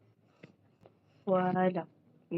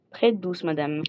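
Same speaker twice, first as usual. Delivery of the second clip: ordinary talk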